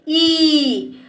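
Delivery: ई held out as one long vowel. Shown as a demonstration. Teacher demonstrates the vowel's sound to pupils, holding it long. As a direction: draw ई out steadily. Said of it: "इसका उच्चारण जब हम बोलते हैं".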